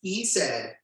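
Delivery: usual speaking voice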